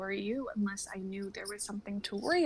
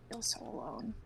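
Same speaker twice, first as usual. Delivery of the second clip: hushed lonely whisper; whispered despair; 6 dB quieter than usual. Acted. Vaughn confessing loneliness to Jonah, whispered. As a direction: barely voice the words.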